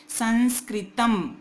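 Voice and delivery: संस्कृतम sounded out slowly with a nasal middle; slow correct reading. Shown as a demonstration. Teacher sounding out संस्कृतम slowly for students. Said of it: "म नहीं आएगा यहाँ पे".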